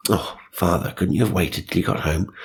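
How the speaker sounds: ordinary delivery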